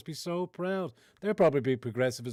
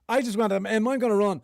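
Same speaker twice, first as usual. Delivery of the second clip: mocking candidate; 8 semitones higher, 7 dB louder than usual.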